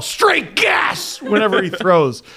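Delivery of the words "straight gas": high, gleeful yell. Retold or imitated. Dad imitating his son's throw celebration, high yell. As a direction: yell it out high like a triumphant kid. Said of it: "I taught him to yell".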